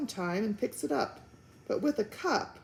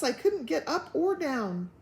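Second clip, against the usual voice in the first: ordinary delivery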